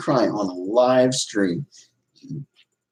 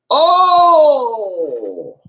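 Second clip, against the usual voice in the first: a high loud hammed-up oh; mock startled cry; 12 semitones higher, 6 dB louder than usual. Streamer fakes being caught out, high loud cry. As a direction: yelp as if caught.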